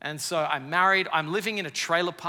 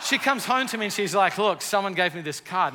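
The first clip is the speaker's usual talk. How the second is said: animated retelling; 5 semitones higher, 7 dB louder than usual. Retold or imitated. Telling wife's reaction, raised.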